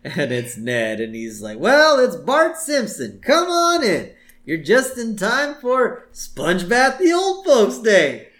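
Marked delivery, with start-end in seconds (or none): 1.6-8.3 high, loud, drawn-out welcome; slow singsong mock greeting, very high; hammed-up cheery greeting. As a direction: welcome him with exaggerated neighborly cheer. Ned greeting Bart, acted.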